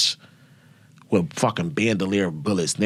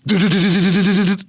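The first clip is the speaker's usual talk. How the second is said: louder, higher rapid do-do-do gunfire; machine gun burst; 12 semitones higher, 5 dB louder, faster than usual. Retold or imitated. Showing the audience how the SAW fires, rapid imitated burst. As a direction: rattle it out like an automatic weapon.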